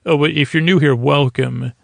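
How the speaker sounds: normal talking voice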